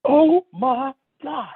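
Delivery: high, drawn-out acted amazement; awestruck exclamation. Friend spotting something down the aisle, mock awe. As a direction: imitate a friend gasping it out, slow and high. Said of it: "Kinda startled me".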